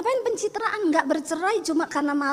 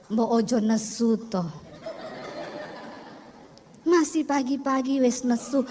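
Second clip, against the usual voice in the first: low, slow, soft-spoken plea; calm him down in a lower voice; lightly voiced Solo husband asking her not to be angry; gentle Javanese husband; 6 semitones lower, 4 dB quieter than usual. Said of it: "Dia datang".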